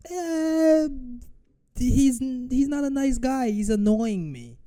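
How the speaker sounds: high, drawn-out whiny voice; hammed-up petty complaint, slow and high; mock whiner. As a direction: whine it like a sulky child. Voicing a reluctant fighter in mockery.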